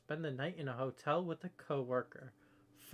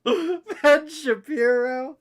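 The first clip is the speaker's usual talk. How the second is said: laughing; 12 semitones higher, 15 dB louder than usual. Real, not acted. Laughing, teasing friend.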